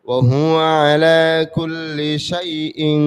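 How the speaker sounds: loud, sustained chanted recitation; Quran recitation. Acted. Teacher reciting the complete verse for students, loud melodic chant. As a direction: intone the whole phrase fully.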